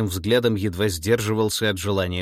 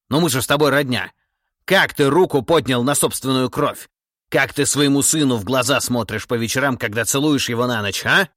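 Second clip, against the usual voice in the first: raised, louder, pressing indignation; outraged reproach; 5 semitones higher, 7 dB louder than usual. Acted. Tsar rebukes his treacherous kinsman, loud, indignant reproach. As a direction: confront a kinsman in appalled disbelief.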